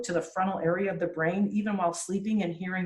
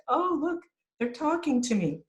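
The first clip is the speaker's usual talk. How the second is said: put-on baby's voice; 9 semitones higher than usual. Voicing the baby's thoughts.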